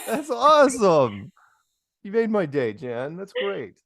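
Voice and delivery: high, drawn-out delighted exclamation; real delight. Real, not acted. Host loving the caller's landline joke, real delight. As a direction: stretch it out high, thrilled and amused.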